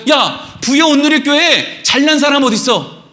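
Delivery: high-pitched, insistent calling-out; Jesus challenging the congregation. Acted. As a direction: search the crowd, demanding they show themselves. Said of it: "예수님이 이렇게 한다고 생각해 보세요".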